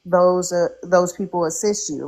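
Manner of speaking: normal talking voice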